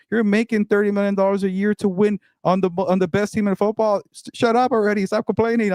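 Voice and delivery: high-pitched exasperated outburst, voice jumping way up; fed-up rant. Real, not acted. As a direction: vent your frustration at full pitch. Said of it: "I'm so tired of".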